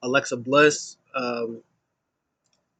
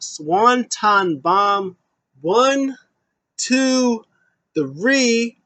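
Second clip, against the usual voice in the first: high, drawn-out dramatic call of the finish; ring announcer count; 8 semitones higher, 4 dB louder, much slower than usual. Acted. Recounting the winning pin, an excited high ring call. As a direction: count it out big and slow.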